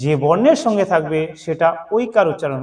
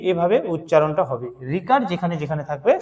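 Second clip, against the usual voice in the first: ordinary delivery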